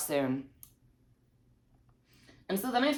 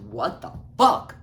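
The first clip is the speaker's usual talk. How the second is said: loud mock "what the fuck"; imagined confrontation; 6 semitones higher, 6 dB louder than usual. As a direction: punch it as if grabbing her.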